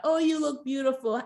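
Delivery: lightly mock the gushing compliment; hint of a fake reality-show compliment. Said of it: "a little fake".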